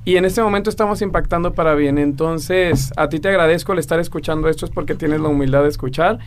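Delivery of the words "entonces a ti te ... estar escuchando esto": ordinary delivery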